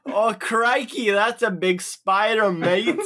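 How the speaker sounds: put-on Australian accent. Player addresses the big spider, mock Australian accent. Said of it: "talk like you're from down under".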